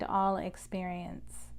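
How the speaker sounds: invocation in short phrases with long pauses; slow call-and-repeat prayer, phrase by phrase; slow, chopped-up prayer. Real, not acted. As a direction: say it slowly, leaving room to repeat after you. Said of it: "I'll say it slowly so that you can repeat after me".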